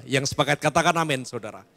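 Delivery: usual speaking voice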